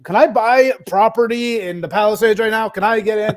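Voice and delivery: high, loud, pushy imitation; loud, high mock eagerness; mock eager callers. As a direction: imitate overexcited callers pestering for a deal.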